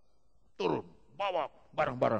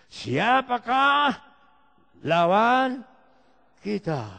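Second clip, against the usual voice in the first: drawn out, loud and high proclamation; preaching climax; 9 semitones higher, 8 dB louder, much slower than usual. Real, not acted. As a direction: stretch siapakah as a triumphant shout. (seruan khotbah yang lantang dan lambat)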